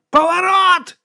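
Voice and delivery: very high, loud yell; shouting. A narrator's reading. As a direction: yell an urgent order across the deck. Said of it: "кричав капітан".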